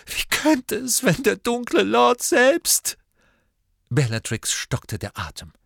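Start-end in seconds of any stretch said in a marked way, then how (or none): 0.1-3.0 very high, desperate outcry; distraught sobbing. A narrator's reading. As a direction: build to a loud tearful wail. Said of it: "schluchzte Narzissa".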